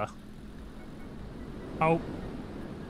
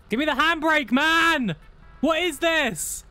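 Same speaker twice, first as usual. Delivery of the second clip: high, exasperated yell; frustrated outburst; 12 semitones higher, 4 dB louder, slower than usual. Real, not acted. Driver fighting a stubborn handbrake, frustrated yell. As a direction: lose patience loudly, pitched right up.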